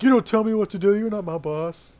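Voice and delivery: loud, high, bratty retort; defiant young dwarf. Acted. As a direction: snap back like a sulky teen.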